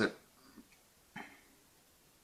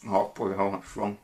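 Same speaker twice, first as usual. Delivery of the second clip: disgusted reaction; 3 semitones lower, 4 dB quieter than usual. Real, not acted. Reacting to the taste of coffee.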